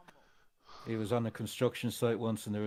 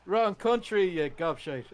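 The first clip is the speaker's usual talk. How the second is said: mock scolding; 7 semitones higher, slower than usual. Teasing his friend about the wrong country, mock scolding. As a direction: scold him fondly, like an exasperated Irish mate.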